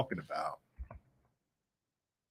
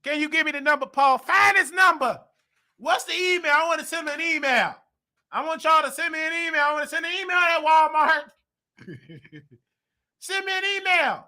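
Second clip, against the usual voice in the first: high, pushy voice barking for an email; lightly hammed-up bossy customer; mock demanding caller; 12 semitones higher than usual. Mocking a caller who wants emails, high and pushy.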